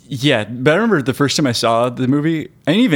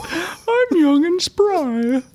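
put-on young asshole; 13 semitones higher, slower than usual. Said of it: "young assholes".

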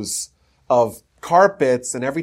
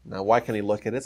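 normal talking voice

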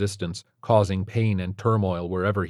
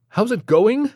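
much higher, louder rising echo of the question; incredulous echo; 7 dB louder than usual. A narrator's reading. Stephen repeats the doctor's question back in disbelief. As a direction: throw his words back in disbelief. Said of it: "Stephen said".

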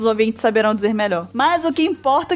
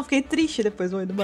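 mock deflated; 4 semitones lower, 5 dB quieter than usual. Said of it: "Eu perdi até a entonação".

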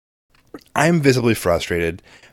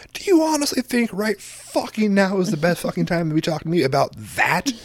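retold anger; 8 semitones higher than usual. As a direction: act out pent-up anger in a raised pitch. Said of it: "that anger emotion from inside out".

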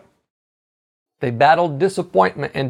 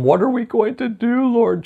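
high, anxious mock plea; worried whine, pitched up; complaining attitude; 5 semitones higher than usual. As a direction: imitate a fretful complaint to God. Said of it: "shifted his attitude from".

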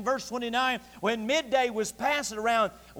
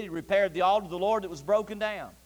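normal talking voice

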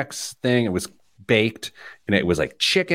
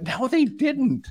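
high, slow teasing no; mocking retort; slower than usual. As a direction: scoff it playfully. Mocking mom's claim.

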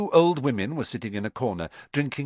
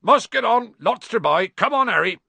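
much louder, higher hearty call; calling over the crowd; 10 semitones higher, 6 dB louder than usual. A narrator's reading. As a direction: shout over a noisy pub. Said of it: "make himself heard over the babble".

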